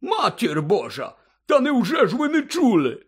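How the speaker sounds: loud, high tipsy amazement; astonished Hagrid. A narrator's reading. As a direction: gasp at them in boozy disbelief. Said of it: "здивувався Гегрід".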